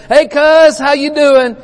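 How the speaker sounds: high, loud, cheery hail across the campus; hearty greeting. Retold or imitated. Old preacher greets young student on campus, loud and cheery. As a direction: call out a big friendly hey cuz. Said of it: "he'd say".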